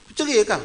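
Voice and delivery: "cerai" pitched high, then dropping; a challenging question with a high "cerai"; questioning intonation. Shown as a demonstration. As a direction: pitch jumps up on "cerai" like a dare. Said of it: "Itu perlu ditanyakan".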